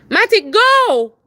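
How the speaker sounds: slow, loud, high final command; stretched shout at the dog; calling the dog. Real, not acted. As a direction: drag out the order.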